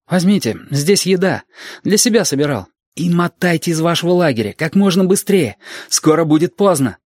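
put-on high-pitched, urgent young man; nervous young soldier. A narrator's reading. Young soldier warns camp to flee, nervous. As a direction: hurry them along in a high, tense voice. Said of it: "парень на нерве".

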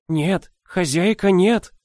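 put-on high, slow, urgent old woman; a frightened old nanny pleading; old woman whisper. A narrator's reading. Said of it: "прошептала старуха".

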